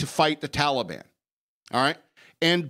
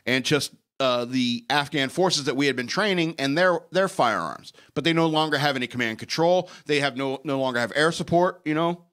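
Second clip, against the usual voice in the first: ordinary talk, nothing marked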